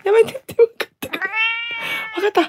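high voice scolding a pet; talking to a cat. Real, not acted.

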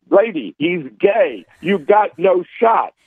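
slow, high mocking put-down. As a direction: drag it out, talking down. Mocking the accuser in a quote.

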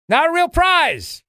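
not a real prize, drawn out slowly and high; emphatic disclaimer. Acted. Stretched ironic disclaimer.

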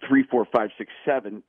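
starts slow then rushes the count; slow start, speeding up. Shown as a demonstration. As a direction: count slowly, then speed up toward the end. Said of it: "slow at the beginning and speeds up at the end".

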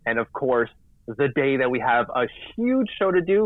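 ordinary talk, nothing marked

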